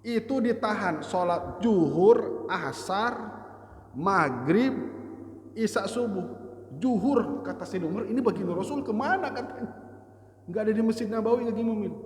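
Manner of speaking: high and loud storytelling climax; fervent preacher; impassioned preaching. Acted. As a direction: build the story to its emotional peak. Preaching at full force.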